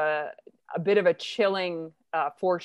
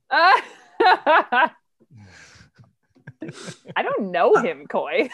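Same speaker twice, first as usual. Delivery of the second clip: laughing; 6 semitones higher, 6 dB louder, much slower than usual. Real, not acted. Teased about name dropping.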